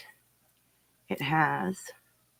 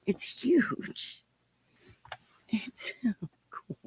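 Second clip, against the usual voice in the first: awed whisper; 3 semitones higher, 8 dB quieter, slower than usual. Real, not acted. Awed at the unwrapped gift.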